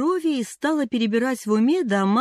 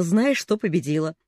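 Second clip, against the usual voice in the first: ordinary delivery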